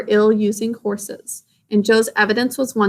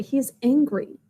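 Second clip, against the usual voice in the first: ordinary delivery, nothing marked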